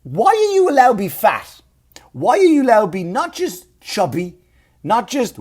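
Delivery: high, loud, incredulous demand; indignant rant. Real, not acted. Comic asking why cops can be fat, indignant. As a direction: hit the question hard in outraged disbelief.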